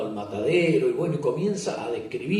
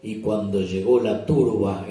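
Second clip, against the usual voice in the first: usual speaking voice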